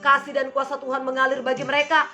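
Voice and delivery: earnest pleading prayer with swelling peaks; fervent prayer. Acted. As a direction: pray with conviction, lifting the nation to God. Pastor leads intercessory prayer.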